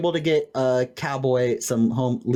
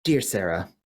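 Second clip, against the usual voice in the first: somber tone; 7 semitones lower than usual. Shown as a demonstration. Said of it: "in, like, a somber tone".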